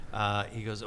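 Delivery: ordinary talk, nothing marked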